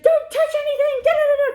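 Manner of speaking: very high, loud squealing command; mock frantic lady, high and loud; panicked order. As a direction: shriek at her not to touch anything. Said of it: "she's like".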